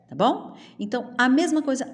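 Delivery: ordinary talk, nothing marked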